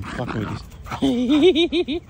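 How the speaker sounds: words dissolve into a high laugh; real laughter breaking out. Real, not acted. Distracted by his dog, laughing.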